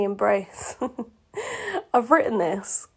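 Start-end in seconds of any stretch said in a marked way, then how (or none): 1.4-1.9 breathy high giggle; embarrassed little laugh. Real, not acted. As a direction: crack up at your own writing. Reader cracks up at own fanfic.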